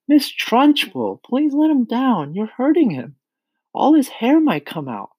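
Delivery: high-pitched, pleading outcry; crying out. A narrator's reading. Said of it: "Miss Honey cried out".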